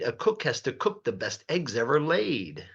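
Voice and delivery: plain reading voice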